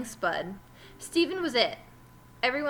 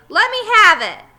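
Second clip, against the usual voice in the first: much louder, higher demand; 10 semitones higher, 16 dB louder than usual. Acted. Terrence demanding the ball from Lewis, loud shout.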